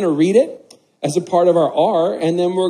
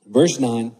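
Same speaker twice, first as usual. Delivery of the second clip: scripture reading; 3 semitones lower than usual. Acted. Reads the scripture passage aloud.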